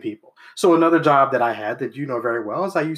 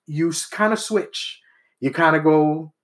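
usual speaking voice

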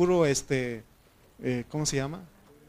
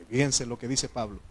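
usual speaking voice

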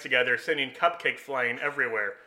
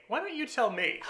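put-on cheeky kid reply; 10 semitones higher, 3 dB quieter than usual. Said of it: "And he would say".

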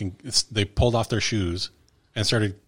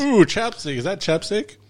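higher, greedy ooh; eager sketch character; 10 semitones higher than usual. Retold or imitated. Alien in sketch asking for chapstick, eager. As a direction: want that chapstick badly.

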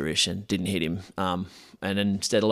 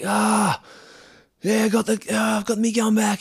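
triumphant; 12 semitones higher than usual. Retold or imitated. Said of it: "he's like".

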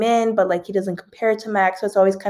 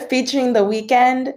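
laughing through a yawn; 4 semitones higher than usual. Real, not acted. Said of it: "I'm gonna yawn again".

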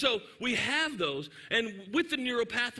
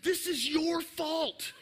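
put-on whiny accusing kid; 8 semitones higher, slower than usual. Child accusing his mother.

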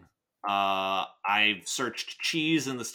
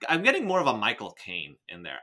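ordinary talk

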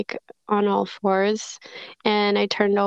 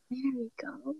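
whisper; 3 semitones higher, 15 dB quieter than usual. Real, not acted. Mother soothing her baby, whispering softly.

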